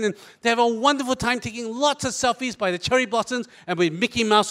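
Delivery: ordinary talk, nothing marked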